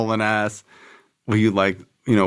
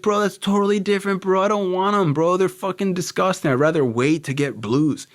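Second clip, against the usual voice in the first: high-pitched protest, easing down at the end; acted heated refusal; 9 semitones higher than usual. Buyer refusing the wrong pills, acted indignation. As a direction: push back, worked up and high.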